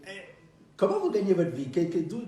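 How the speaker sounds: ordinary delivery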